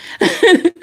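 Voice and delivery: real, high-pitched giggle; amused laugh. Real, not acted. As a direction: laugh at your own slip. Laughing at own odd accent.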